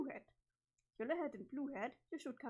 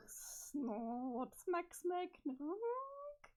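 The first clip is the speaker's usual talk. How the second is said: silly clown; 7 semitones higher, much slower than usual. Acted. Clown honking wake-up noises.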